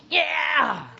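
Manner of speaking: loud, high comic zap scream; mock tasered yell. Pastor playfully acts out tasering the lady, high mock yell. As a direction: yelp as if hit by a taser.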